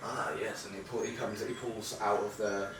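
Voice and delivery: lightly voiced old fisherman, mild and halting. Old fisherman answers travellers about his river, halting and mild. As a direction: play a gently rambling old river fisherman.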